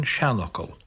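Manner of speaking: seanchal with main stress first, lighter stress after; primary then secondary stress. Shown as a demonstration. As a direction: hit the first part of seanchal hardest. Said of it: "primary stress followed by secondary".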